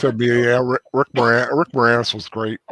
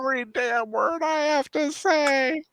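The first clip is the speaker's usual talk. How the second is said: imitating; 14 semitones higher, 4 dB quieter, slower than usual. Quoting the Wedding Singer song.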